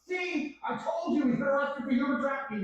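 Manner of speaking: high, mocking imitation of online accusers. Mimicking gleeful online critics, high and mocking. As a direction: sneer it out high as a gloating critic.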